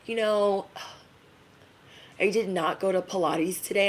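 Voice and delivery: soft sighing grumpy friend. Retold or imitated. Grumpy Pilates friend complaining to her pal, soft and sighing. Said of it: "she's like".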